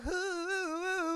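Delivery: high, sustained hooting whoop; turning it around. Retold or imitated. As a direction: let out a long playful high hoot. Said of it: "turn it around and be like".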